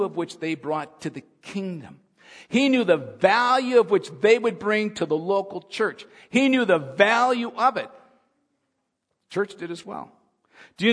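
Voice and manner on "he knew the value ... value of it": rising louder, higher emphasis on he; building preaching. Acted. Preacher stressing Jesus valued children, building loud. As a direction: build the fervor line by line.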